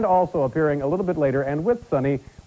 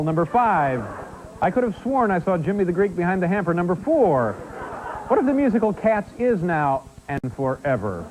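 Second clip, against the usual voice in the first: raised-pitch comic list announcer; countdown list reading; 5 semitones higher than usual. Acted. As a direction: read out each item as a mock-terrifying thought. Reads out the top ten list.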